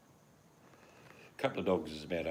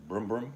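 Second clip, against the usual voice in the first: mock car noise; 6 semitones higher, 7 dB louder than usual. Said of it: "Our old Chihuahua used to say".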